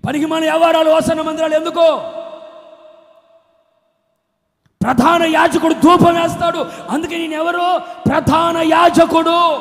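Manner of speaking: loud, high preacher's shout; full-force sermon cry; fiery preaching. Acted. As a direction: thunder it from the pulpit.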